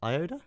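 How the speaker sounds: Iota as eye-OH-duh, high and questioning; puzzled try at Iota, eye-OH-duh lifted like a question; unsure pronunciation. Real, not acted. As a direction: ask Iota as eye-OH-duh, pitch rising. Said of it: "Is that how you say it?".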